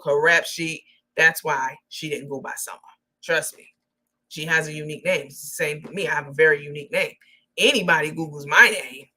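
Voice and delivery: normal talking voice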